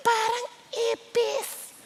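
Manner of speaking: high, soft, slow coo; tiny high voice, drawn out adoringly; delighted cooing. Acted. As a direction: gush softly over a child's singing. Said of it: "Pero tuwan-tuwa tayo".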